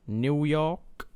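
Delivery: a quick put-on American accent on the city name. Gamer slips into an American accent reading a city name. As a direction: say it like an American, half joking. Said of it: "refrain from my American accent".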